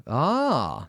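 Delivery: "ah" as a wide open AW; wider vowel. Shown as a demonstration. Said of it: "using a wider sound".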